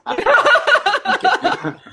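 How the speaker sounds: high squeal through laughter; laughing. Real, not acted. Teasing the co-host, laughs.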